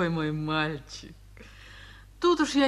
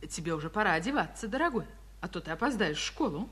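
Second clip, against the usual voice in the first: ordinary delivery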